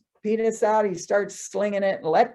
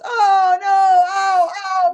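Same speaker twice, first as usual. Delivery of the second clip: high, loud pained howls; stuck man howling while she pulls him; screaming in pain; 10 semitones higher, 9 dB louder, much slower than usual. Acted. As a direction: howl as it tears. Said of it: "He's crying and screaming and hollering".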